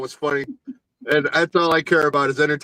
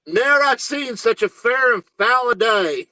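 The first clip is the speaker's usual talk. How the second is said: mock hillbilly Macbeth; 5 semitones higher, slower than usual. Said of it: "all the Shakespeare I know".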